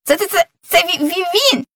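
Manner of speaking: high, excited stuttering hare; stammering cry. Acted. Hare recognises the boy, crying out with a stammer. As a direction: cry out, stuck on the first sounds. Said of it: "закричав заєць Сергій".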